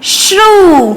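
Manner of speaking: श्रू with a long, held "oo"; syllable demo. Shown as a demonstration. Teacher adding the vowel sign to श्र, श्रू drawn out. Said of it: "श्र पर लगेगी तो".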